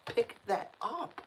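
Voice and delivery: high, slow mock telling-off; indignant scolding. Retelling scolding the rude neighbour, indignant and slow. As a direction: scold him slowly, as if he should know better. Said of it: "I'm like".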